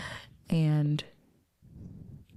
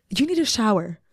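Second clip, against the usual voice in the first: firm urging; 6 semitones higher, 4 dB louder than usual. Retold or imitated. Friend firmly urges shower.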